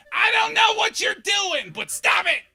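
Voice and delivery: high, very loud neighborhood busybody yell; yelling out window. Acted. Mrs. Kowalski policing the street from her window, shrill holler. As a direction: holler down at the kids, stretching it out. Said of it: "She would just yell out her window".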